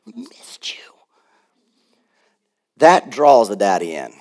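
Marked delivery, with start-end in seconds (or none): 0.1-0.8 put-on high, whispery kid voice; tiny whispered child's greeting; adoring kids. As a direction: mimic a child in a soft, airy high whisper. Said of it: "They were praising Daddy".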